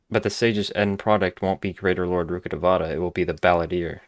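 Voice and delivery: ordinary delivery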